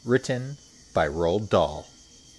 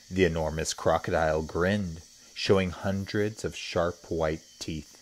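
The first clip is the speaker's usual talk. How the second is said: plain reading voice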